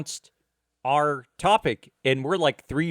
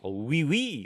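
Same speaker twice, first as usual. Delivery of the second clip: mock French accent. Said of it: "that's all we got".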